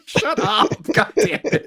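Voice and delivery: high, real laughter through the words; laughing helplessly. Real, not acted. Podcaster trying not to laugh at co-host, laughing helplessly. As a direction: scold while cracking up. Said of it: "I don't wanna laugh at you".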